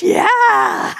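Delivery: high, loud drawn-out cheer; delighted whoop. Retold or imitated. Servant woman answers the joke, imitated gleeful shout.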